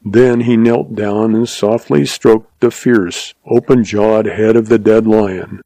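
usual speaking voice

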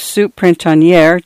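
plain reading voice

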